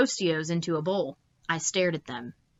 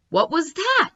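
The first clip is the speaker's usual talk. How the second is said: high, louder question; startled woman; 10 semitones higher, 4 dB louder than usual. Acted. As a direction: gasp it like a startled sailor woman. Woman's voice voiced.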